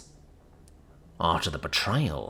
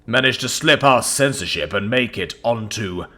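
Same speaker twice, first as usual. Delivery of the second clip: booming; 8 dB louder than usual. Acted. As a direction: command the room, booming. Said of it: "he booms".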